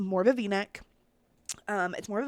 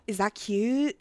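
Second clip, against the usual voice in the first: gasping question; 4 dB louder, slower than usual. Real, not acted. First take, gasping at outfit.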